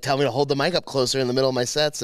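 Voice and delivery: normal talking voice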